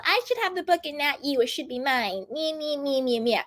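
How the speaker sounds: put-on a light whiny childish voice. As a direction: sulk like a spoiled kid, lightly played. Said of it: "whiny and like a child".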